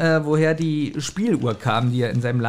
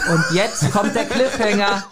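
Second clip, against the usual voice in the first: drawn out, higher, to hype the cliffhanger; building suspense; 5 semitones higher than usual. Shown as a demonstration. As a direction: lean into der Cliffhanger like a trailer voice. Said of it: "ich probiere jetzt Spannung aufzubauen". (Spannung aufbauen, etwas gedehnt und höher)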